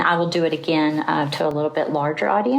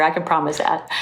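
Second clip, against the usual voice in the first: normal talking voice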